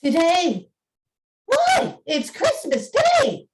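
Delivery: put-on high, eager child's voice; a delighted boy shouting up; excited boy. Boy answers Scrooge excitedly.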